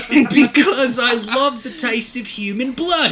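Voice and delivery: high giggly mock-English character voice; giggling. A narrator's reading. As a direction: giggle through a put-on English accent. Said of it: "he giggled".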